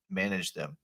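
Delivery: ordinary talk, nothing marked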